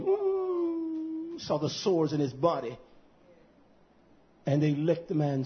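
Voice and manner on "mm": long, soft, high-pitched "mmm"; imitated hum. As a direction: hold a quiet high hum, imitating an onlooker. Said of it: "looked at him saying".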